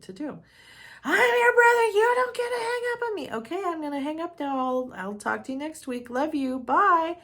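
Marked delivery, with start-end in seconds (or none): 1.0-3.3 very high, loud, fast outraged yell; screaming brother imitation. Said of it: "made it even worse". 3.3-7.2 chirpy, much higher singsong goodbye, fast; breezy sign-off. Retold or imitated. Cheerfully ending call on yelling brother.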